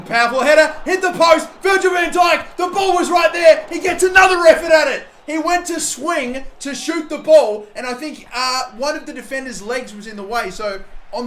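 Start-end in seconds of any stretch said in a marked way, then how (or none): 0.0-9.6 high, loud shouting commentary; excited yelling. Real, not acted. Gamer watching his striker hit the post, yelling high and loud. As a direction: scream it as the header hits the post. Gamer yells at a near-miss chance.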